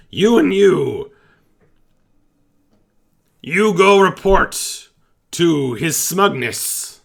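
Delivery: high, loud, proud goblin voice; goblin captain. Acted. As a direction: bellow it like a pompous little goblin captain.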